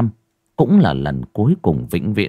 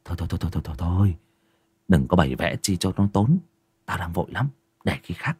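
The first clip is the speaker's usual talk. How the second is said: hurried refusal; 2 semitones lower, 6 dB quieter, much faster than usual. Acted. Den quickly refusing the feast, soft and hasty. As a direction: wave it off quietly. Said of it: "vội vàng xua tay".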